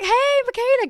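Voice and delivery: a fan eagerly greeting a bubbly creator; excited hello. Retold or imitated. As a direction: call her name like a thrilled fan. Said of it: "you'd be like".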